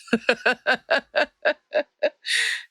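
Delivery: high, delighted burst of laughter; laughs. Real, not acted. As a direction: break into a bright, high laugh. Laughing at her own punchline.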